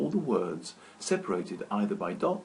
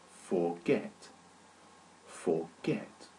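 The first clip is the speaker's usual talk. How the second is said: Forget softer, stress on get, rising; two-syllable stress; 6 semitones lower, 5 dB quieter, much slower than usual. Shown as a demonstration. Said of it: "this verb has two syllables".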